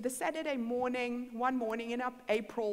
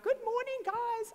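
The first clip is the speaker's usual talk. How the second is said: high, louder, sing-out morning hello; chirpy greeting; 13 semitones higher, 4 dB louder than usual. Retold or imitated. Speaker greeting housemates that morning, chirpy and high. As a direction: call it out brightly in a raised pitch. Acted cheerful greeting in the story.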